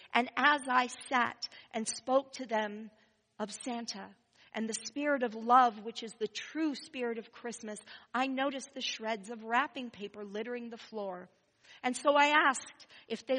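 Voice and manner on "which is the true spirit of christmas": normal talking voice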